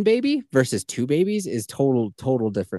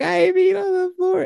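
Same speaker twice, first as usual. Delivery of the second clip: put-on high, slightly louder little-girl confession; little daughter voice; 5 dB louder than usual. Daughter admitting accident to dad, high child voice. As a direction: sing it up high like a toddler owning up. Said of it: "She's like".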